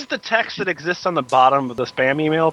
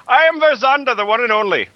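grand mystic voice; 10 semitones higher than usual. Pretend. Playing mystic dream interpreter Varzanda.

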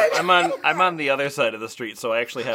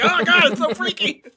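mock scared; 14 semitones higher, 5 dB louder than usual.

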